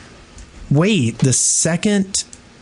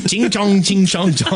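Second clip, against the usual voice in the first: high, sing-song mock Chinese; hammed-up fake Chinese syllables; 6 semitones higher than usual. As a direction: crude parody of Chinese in a higher voice. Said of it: "Can you do it in Chinese?".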